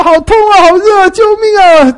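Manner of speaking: high, loud hammed-up screams for help; mock cries of pain. Burning king that he did not say, high mock scream. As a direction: shriek like a man burning. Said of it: "也不说".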